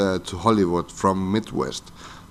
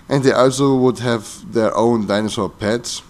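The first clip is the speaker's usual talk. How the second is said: usual speaking voice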